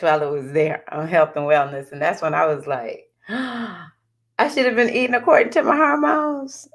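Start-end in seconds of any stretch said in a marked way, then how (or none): 3.2-6.7 mock realization. Vlogger recalling what Uranus taught her, mock realization. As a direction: act out a sudden revelation, pitched up.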